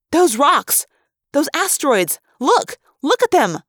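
high, loud, urgent exclamations; alarmed excitement. A narrator's reading. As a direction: cry out to make someone look right now. Girl urgently points out moving asteroids.

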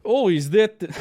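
put-on Ukrainian accent. Imitating the tattoo artist's question, in a Ukrainian accent. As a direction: slip into a Ukrainian accent, then break off. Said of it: "I'm not gonna do his accent".

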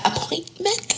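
"appointment" crisp and high with every syllable said; "appointment" said carefully in a posh phone voice, rising at the end; proper "appointment". Retold or imitated. Caller showing off her phone voice, saying "appointment" crisply. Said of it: "I have to hit it with that".

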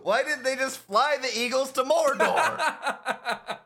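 high, slow, through laughter; joke-shout while laughing; laughing rant. Real, not acted.

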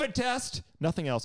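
squeaky high dismissive voice; mocking the PA. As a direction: parody a breezy nurse. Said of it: "She's like".

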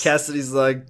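normal talking voice

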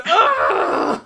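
exasperated ugh; frustrated groan. Acted. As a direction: groan in annoyance. GM voices frustrated target.

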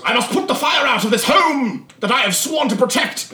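high, loud, prim butler voice; a proper servant vowing to save the house; dutiful butler. Acted. Butler announcing duty to the party, prim and high. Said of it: "the butler goes".

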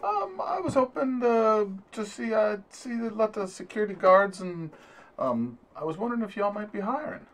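high-pitched, courteous character voice; polite job seeker. Acted. Visitor asks receptionist about a job, high-voiced and polite.

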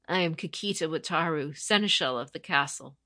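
usual speaking voice

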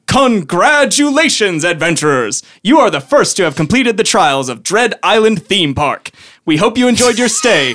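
theme-park announcer at full volume; booming announcer. Acted. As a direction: boom it out to the adventurers. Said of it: "A loud voice booms".

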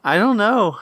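put-on high child voice; upset little kid. Child answering mom's question, high upset kid voice.

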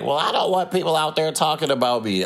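high-pitched mock objection; mimicked complaint. Mimicking the complaining ladies in argument.

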